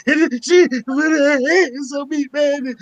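slow, mock-broken cracking voice; hammed-up trembling victim voice. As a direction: fake a quavering voice for the cops. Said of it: "I'ma give him that cracking voice".

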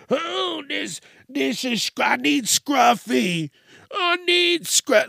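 high, slow pleading whine, voice cracking; high whimpering plea, drawn out; mocking whiny dog owner. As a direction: mimic a needy owner begging for her dog.

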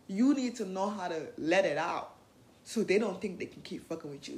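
ordinary talk, nothing marked

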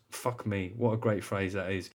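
ordinary talk, nothing marked

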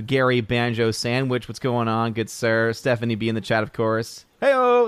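normal talking voice

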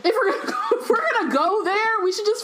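high, laughing outburst. Real, not acted. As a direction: squeal it through laughter. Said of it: "was that?".

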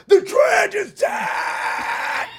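high, drawn-out singer's scream; imitated metal scream. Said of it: "he's just screaming".

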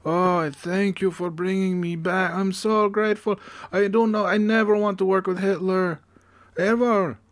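high-pitched mock Italian voice; comic high Italian accent. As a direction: plead gratefully in a hammed-up Italian accent. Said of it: "That's my Italian voice".

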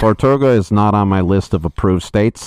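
stern tired clerk; DMV clerk. Pretend. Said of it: "person from the D M V".

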